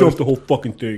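ranting at the rival podcast, mock-shouted; mock angry shout. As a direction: bellow it like a fake tirade. Mock rant against rival podcast.

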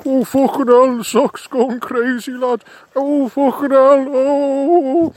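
high, loud comic panic; beside himself. Acted. Old man watching a sock go crazy, panicked yelling. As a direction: wail in alarm, pitch shooting up. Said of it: "The old man was beside himself".

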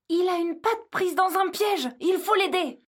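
alarmed and eager to help; gasp, then urgent plea. Acted. Gasps, sees trapped deer.

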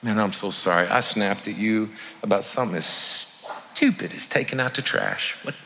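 quiet heartfelt apology, hushed; tender apology to a child. Shown as a demonstration. Parent apologizing to their kid over the trash, soft and tender. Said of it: "With your kiddos".

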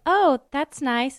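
mock-sweet before the kill; sweet Sarah. Host imitating Sarah, slow and sugary. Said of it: "Sarah's more like".

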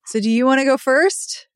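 ordinary talk, nothing marked

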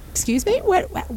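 high-pitched retold astonishment; hammed-up, incredulous rise in pitch; mock disbelief. Audience member retelling an unexpected star appearance, mock indignant disbelief. Retelling surprise at seeing the actor.

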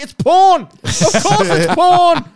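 high loud drawn-out yell; mock shouting. Telling the scam caller what he watches, loud high mock yell. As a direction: bellow it out slowly in fake outrage. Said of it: "And I was like".

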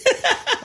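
short, very high burst of laughter. Real, not acted. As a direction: laugh at his own tale. Laughing at own story.

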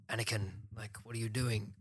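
softly spoken, understated character impression; lightly voiced film mentor, kept quiet; Liam Neeson. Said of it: "that's a good Liam Neeson impression".

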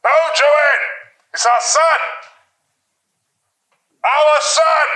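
high loud call across the house; shouting to another room. Acted. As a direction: yell it down the hall. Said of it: "shouts from the other room".

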